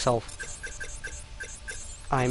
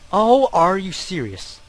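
loud, higher, indignant protest; exasperated disbelief; 5 semitones higher, 5 dB louder than usual. Real, not acted. Gamer missing the input after pleading, exasperated. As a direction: challenge the game in disbelief.

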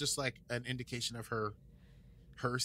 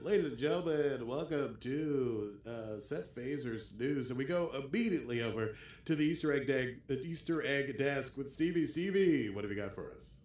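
news announcer; 3 semitones higher than usual. Pretend. Host throwing to the Easter egg desk, mock news announcer. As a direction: play the newscast host handing over.

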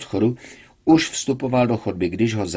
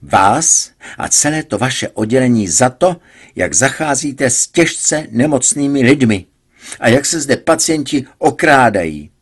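angry; 4 semitones higher, 8 dB louder than usual. A narrator's reading. Patient shouting at doctor.

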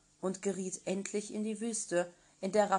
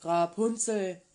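louder, slow call up the tower; 6 dB louder, slower than usual. Acted. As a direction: call her name up to the window. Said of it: "kam und rief".

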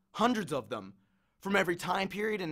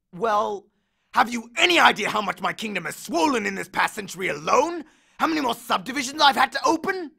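louder, higher indignant god ranting; angry underworld god; 5 semitones higher, 4 dB louder than usual. Acted. Hades vents to Percy, indignant rant. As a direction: rant like an overworked king.

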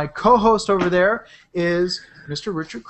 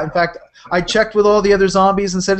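ordinary delivery

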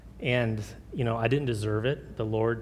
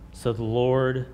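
ordinary talk

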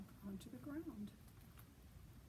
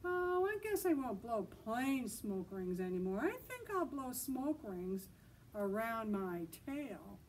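louder, showing-off boast; loudly; 3 semitones higher, 5 dB louder than usual. Acted. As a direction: announce it so they all hear. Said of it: "So he said loudly".

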